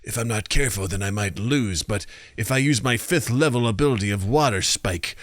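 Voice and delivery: hushed, mock-dramatic inner thoughts; anime internal monologue. Imitating a fighter thinking mid-battle, mock-earnest internal monologue. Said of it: "there are some anime where the internal dialogue".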